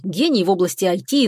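plain reading voice

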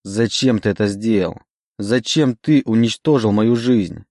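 ordinary delivery, nothing marked

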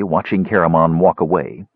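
ordinary delivery, nothing marked

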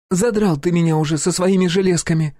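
high, exasperated woman's voice; fed-up outburst. A narrator's reading. Pupil fed up with instructor's gun parts, angry. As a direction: complain angrily in a high voice. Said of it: "психанула она".

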